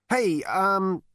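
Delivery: high, loud-ish hesitant call; stammering. A narrator's reading. Zaphod to the unseen voice, flustered stammer. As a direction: call out, then falter. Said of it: "he stammered".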